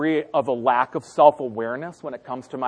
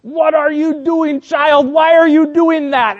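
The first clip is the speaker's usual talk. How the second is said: put-on high, loud, slow scold at the child; scolding mother; 11 semitones higher, 5 dB louder, slower than usual. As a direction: mom scolding the toddler. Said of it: "And Reg is like".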